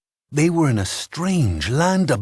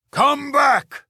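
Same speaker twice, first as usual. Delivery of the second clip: roar. A narrator's reading. Giant shouts after the fleeing farmers, roaring. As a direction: bellow after them. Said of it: "the giant roared".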